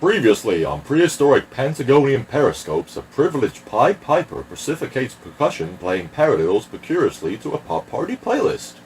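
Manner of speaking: very fast alliterative patter; rapid-fire recap announcer. Acted. Announcer recapping a show, rapid tongue-twisting patter.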